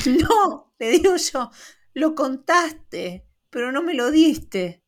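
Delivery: high, slow, spelling it out to the cashier; mock exasperation; exasperated. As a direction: lay it out slowly, losing patience.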